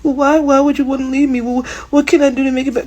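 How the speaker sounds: high, fast, whiny pleading imitation; mock begging girlfriend. Mocking a woman who begs her leaving man, high whiny plea. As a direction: mimic a desperate woman begging him to stay. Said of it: "don't you dare cry and beg".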